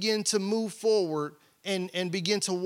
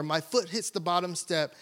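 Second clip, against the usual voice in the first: normal talking voice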